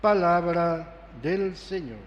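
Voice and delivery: normal talking voice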